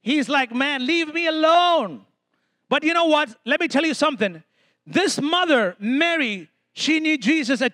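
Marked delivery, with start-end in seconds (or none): 0.5-2.1 drawn-out, exasperated complaint; mock-irritated plea, stretched out slowly. Jesus brushing off his mother at the wedding, irritated. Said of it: "He's irritated".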